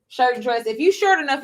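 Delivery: ordinary talk, nothing marked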